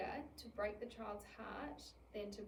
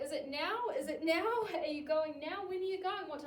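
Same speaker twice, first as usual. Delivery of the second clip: high, loud, fretful repeated questions; anxious impatient questioning, higher and louder; anxious nagging; 6 semitones higher, 9 dB louder than usual. Retold or imitated. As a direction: pester like a nervous kid who can't relax. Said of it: "you're gonna be like".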